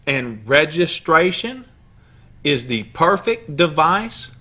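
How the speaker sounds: slow, emphatic read-aloud with long pauses; measured group-reading delivery, each phrase left hanging; deliberate quote reading. Acted. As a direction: read it out phrase by phrase, slowly and firmly. Reads a quotation aloud with audience.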